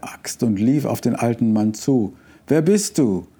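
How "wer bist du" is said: raised, higher-pitched challenge; mild shout. A narrator's reading. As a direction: call out sharply, but not at full volume. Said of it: "schrie er". (verhaltener Schrei)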